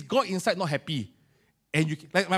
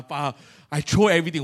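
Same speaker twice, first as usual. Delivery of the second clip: ordinary talk, nothing marked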